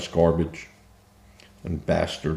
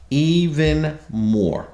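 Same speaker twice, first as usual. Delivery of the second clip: slow emphatic repeat; 7 semitones higher, much slower than usual. Real, not acted. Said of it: "repeats for emphasis".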